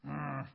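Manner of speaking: short storybook growl; acted "grr". A narrator's reading. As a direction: voice a cartoon grumble of frustration. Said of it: "That's what that sounds like".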